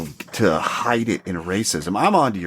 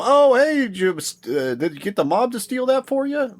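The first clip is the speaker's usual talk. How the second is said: much higher, sneering coworker jabbing about the mob; mock-friendly ribbing, pitched way up. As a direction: put on a high, teasing office voice. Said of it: "You go".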